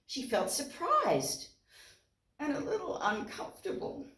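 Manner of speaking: usual speaking voice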